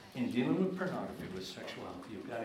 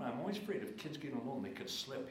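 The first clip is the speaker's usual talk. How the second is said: normal talking voice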